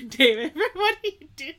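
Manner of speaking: giggling through the words; can't hold back the laughter; laughing. Real, not acted. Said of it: "Are you trying not to laugh right now?".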